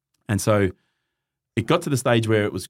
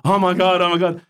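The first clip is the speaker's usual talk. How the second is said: excited manager; 9 semitones higher, 4 dB louder than usual. Retold or imitated. Manager recognizing him, thrilled.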